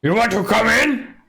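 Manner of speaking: high, loud orc; dim orc voice. Acted. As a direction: dumb orc asking. GM voicing orc.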